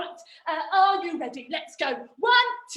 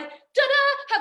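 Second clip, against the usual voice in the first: high little fanfare; triumphant reveal; 8 semitones higher, 3 dB louder than usual. Acted. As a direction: pop out a showy ta-da.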